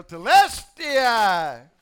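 Telestai drawn out slowly and loud; victory yell. Pretend. Preacher yelling the victory cry, loud and drawn out. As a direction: shout Telestai long and triumphant. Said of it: "I too can yell".

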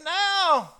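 high, loud desperate shout; one frantic shouted word; panicked scream. Acted. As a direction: yell it in terror. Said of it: "Did you hear him screaming?".

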